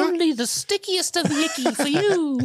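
very high, squeaky elf dealer; Dobby impression. Dobby pitching weed to Harry.

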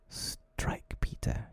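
low, soft, understated resolve; firmly. A narrator's reading. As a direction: offer it low and firm. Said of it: "he said firmly".

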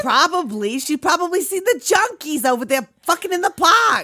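loud, high-pitched Bostonian riffing; mock Boston accent. Friend riffing on junkies in the park, in a mock Boston accent. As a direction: go full hammed-up Boston. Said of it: "Just got possessed by a Bostonian".

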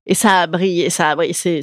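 normal talking voice

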